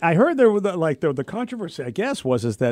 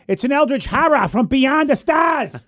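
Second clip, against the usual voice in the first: put-on slow, higher Boston accent; 7 semitones higher, 3 dB louder than usual. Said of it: "giving all the characters Boston accents".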